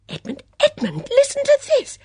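very high urgent summons; excited call. Acted. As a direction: call the son over, thrilled. Calls son excitedly.

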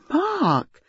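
high-pitched, mock-scolding cry; exclaimed protest, part amused, part cross; half laughing, half angry. A narrator's reading. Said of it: "half laughing and half angry".

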